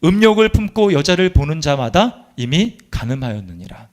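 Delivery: solemn, measured reading of the verse; scripture recitation. Acted. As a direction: read the scripture with weight.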